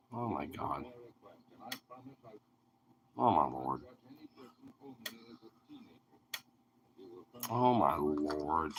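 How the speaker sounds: low, strained groan; deep exasperated groan. Real, not acted. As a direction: groan it out, pinned down. Groaning under the dog's weight.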